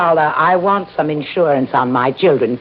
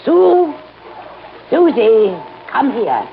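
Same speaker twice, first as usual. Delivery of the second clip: calling out; 7 semitones higher, slower than usual. Acted. The old professor calling her dolphin Sue up to the surface. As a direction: summon the dolphin up from the water.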